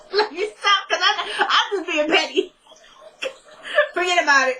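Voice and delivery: can't stop giggling, trying to move on; real laughter. Real, not acted. Vlogger cracking up at her own petty wardrobe rant.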